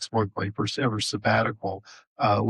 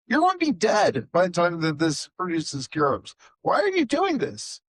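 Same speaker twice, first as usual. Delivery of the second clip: much higher, rising disbelief; incredulous Honi; 7 semitones higher than usual. Retold or imitated. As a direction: climb higher into disbelief on the question.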